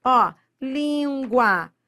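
"língua" stretched out slowly for the class; slow demo. Shown as a demonstration. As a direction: draw out "língua" so they hear each sound. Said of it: "vou pegar só uma delas pra você ver". ("língua" bem devagar, demonstrando)

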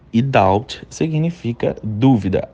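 ordinary talk

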